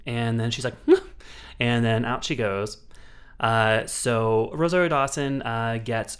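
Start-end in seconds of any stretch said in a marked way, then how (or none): 0.8-1.5 high mock evil giggle; a short hammed-up killer cackle; villain laugh. Killer laughs after stabbing.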